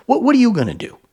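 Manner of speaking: high, loud mocking taunt; high sneering challenge to the viewer. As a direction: throw it out as a sneering dare. Mocking the cop's stance.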